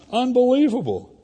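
high, loud disbelief; incredulous outburst. Real, not acted. Pastor to congregation, loud disbelieving outburst. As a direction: throw out the word in amazement.

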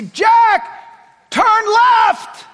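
God shouting a blunt order at him; yell. Pretend. Preacher imagining God yelling an order. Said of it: "I wish ... that you'd yell".

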